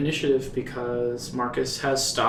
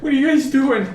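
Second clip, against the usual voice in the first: high, whimpering plea for mercy; terrified horror victim; 8 semitones higher than usual. Acted. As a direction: cower and beg, pitched way up. GM voices cowering captive NPC.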